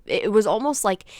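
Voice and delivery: normal talking voice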